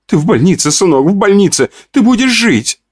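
put-on high, overjoyed old mother; joyful mother. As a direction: cry it out in relief. Mother rejoices.

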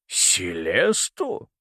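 very slow, drawn-out disbelief; incredulous question. A narrator's reading. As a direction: stretch the name into a rising question. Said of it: "брови Ориона взмыли вверх". (протяжно, с недоверчивым удивлением)

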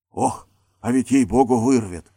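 ordinary delivery, nothing marked

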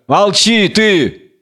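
mildly acted scolding; sharp silencing. A narrator's reading. Jailer silences frightened prisoner.

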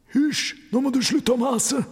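high-pitched hushing rebuke; Pelle scolding. A narrator's reading. Pirate hushing his nagging mate during the robbery, scolding. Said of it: "kjefter Pelle".

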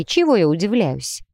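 plain reading voice

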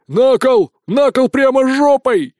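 loud, high angry chanting; frenzied mob shout. A narrator's reading. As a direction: rage like a peasant mob. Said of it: "неистовствовали крестьяне-пахари". (неистовый крик разъярённой толпы)

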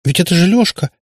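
high, agitated exclamation; возглас. A narrator's reading. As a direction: cry out in protest. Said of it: "воскликнула Катя". (взволнованно восклицая)

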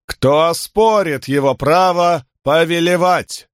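high, slow herald's proclamation; town crier. Acted. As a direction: call it out to the whole arena. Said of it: "могучий голос глашатая".

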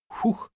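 short, sudden blow of air; sharp puff. Acted. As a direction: blow powder away with one puff. Old man blows powder in face. (резкий выдох)

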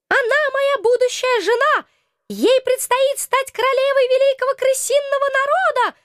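high squeaky rat king, self-satisfied; smug squeaking rat voice. Acted. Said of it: "самодовольно сообщил ... крысиный король".

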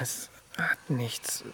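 slow, voiceless whisper. Acted. Answering his partner's question, slow whisper. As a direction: barely breathe the denial. Hushed reply between partners.

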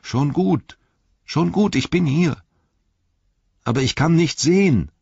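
high, strained call back; Antwortruf von unten. A narrator's reading. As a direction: call up from below to reassure, then distressed. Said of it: "kam ein leiser Antwortruf von unten". (hoher Ruf aus der Tiefe)

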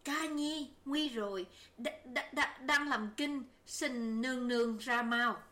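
frightened maid stammering bad news; panicked stammer. A narrator's reading. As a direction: gasp it out in a panic, tripping on the words. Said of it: "hỗn hển vào nói".

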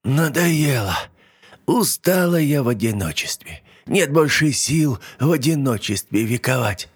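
put-on high, tired old hag lament; weary old witch. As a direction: sigh like a lonely witch. Yaga laments.

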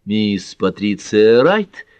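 plain reading voice

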